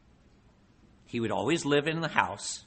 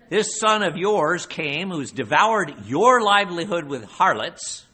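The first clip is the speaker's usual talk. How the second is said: bitter accusation; 5 semitones higher, 8 dB louder than usual. Acted. Older son's bitter accusation.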